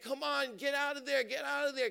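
will him out of the current; urgent cheering. Retold or imitated. Friends urge the cousin out of the riptide, high and urgent.

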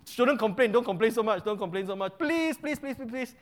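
high, loud mock pleading, fading at the end; hammed-up begging for mercy; begging to Moses. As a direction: grovel to Moses, exaggerated. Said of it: "and then".

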